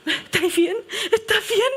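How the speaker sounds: pregunta asustada imitada entre risas; imitando riendo. Retold or imitated. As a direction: repite la pregunta del pastor sin poder parar de reír. Pastor Juan Carlos alarmed, retold laughing. (el pastor Juan Carlos pregunta asustado, imitado riendo)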